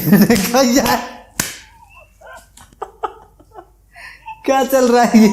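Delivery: real laughter at the absurdity; laughing. Real, not acted. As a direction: blurt it out cracking up.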